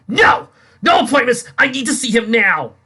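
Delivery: very loud, high desperate yelling; refuse, shouting wildly; desperate man yelling at secretary; frantic shout. Acted. Dick demands to see Wayne.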